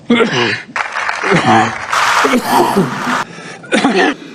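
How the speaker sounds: long run of separate coughs; coughing fit. Real, not acted. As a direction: cough repeatedly before carrying on. Long coughing fit interrupts speech.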